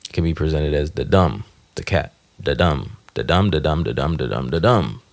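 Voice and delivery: da-DUMB, hitting dumb; iambic beat. Shown as a demonstration. Explaining a foot, chanting the DUMB beat. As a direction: stress dumb each time. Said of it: "unstressed and cat is stressed".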